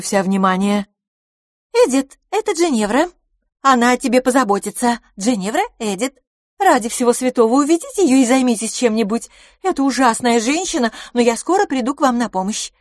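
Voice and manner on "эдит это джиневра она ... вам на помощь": high, loud, hurried hostess; harried Miss LaFosse. A narrator's reading. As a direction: plead for help with a pest, high-voiced. Miss LaFosse speaks in story. (высокий, громкий умоляющий голос хозяйки)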